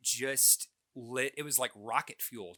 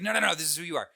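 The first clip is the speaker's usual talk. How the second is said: louder, higher mom declaring it; insistent mom; 6 semitones higher, 8 dB louder, much faster than usual. Retold or imitated. Mom insisting her son is a band fan, louder. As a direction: lay down the law to your boy. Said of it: "She's like".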